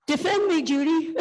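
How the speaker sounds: a high mock whine of a scared husband; high, pleading, hammed-up cry for help; mock helpless plea. Imitating a cowardly ex-husband begging his wife, high mock plea. Mocking what ex-husband would say.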